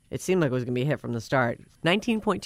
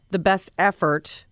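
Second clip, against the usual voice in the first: normal talking voice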